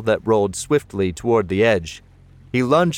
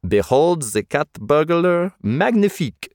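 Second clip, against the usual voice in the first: mock French accent, higher and grand; 7 semitones higher than usual. A narrator's reading. Jeff showing off to Cindy in a French accent. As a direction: ham up a French cat burglar voice. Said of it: "he said in his best French accent".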